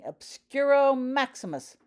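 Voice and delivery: booming, high commanding spell; loud commanding. A narrator's reading. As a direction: command the house to seal.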